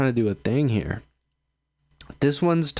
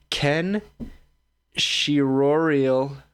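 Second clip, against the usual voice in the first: slow careful sounding-out; 3 semitones higher, much slower than usual. Shown as a demonstration. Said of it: "I'm about to botch this".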